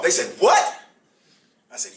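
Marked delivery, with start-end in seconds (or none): 0.4-0.8 high, loud startled what; mock child's disbelief, pitched up high; surprised kids. Father retells his children's shocked reply, high and loud.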